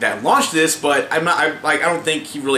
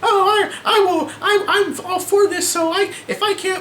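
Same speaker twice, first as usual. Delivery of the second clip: mocking woman. Mocking women who back this, in a high put-on voice. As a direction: mimic a naive woman in a high voice. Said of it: "women out there who are just like".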